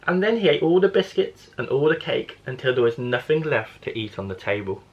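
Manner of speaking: ordinary delivery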